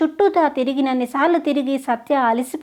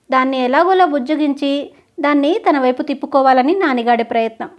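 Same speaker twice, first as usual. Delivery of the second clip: ordinary delivery, nothing marked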